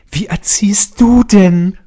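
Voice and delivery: high, loud, slow indignant scolding; aggressive scolding. Acted. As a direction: scold like an outraged mother. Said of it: "so vorgelesen hab".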